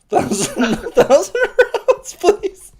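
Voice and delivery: high, loud, giggling through the words; can't keep a straight face asking; laughing high plea for a thousand rounds; laughing request. Pretend.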